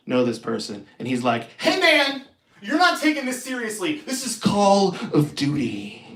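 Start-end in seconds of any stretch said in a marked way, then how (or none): 1.6-6.0 higher, earnest gamer voice; a hint of a scolding online gamer; lightly voiced serious gamer; intense gamer.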